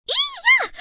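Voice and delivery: high startled squeal. Acted. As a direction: shriek in shock at the poke. Startled by being poked.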